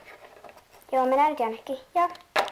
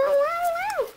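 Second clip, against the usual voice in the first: high pained yelps; ouch cries. Pretend. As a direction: yelp as the wound stings. Kid hurt cleaning wound. (kivusta vinkuen)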